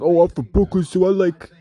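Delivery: high, loud mocking fan voice; mock Brooklyn fan. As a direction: poke fun at a proud Brooklyn fan. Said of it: "I'm not all about this".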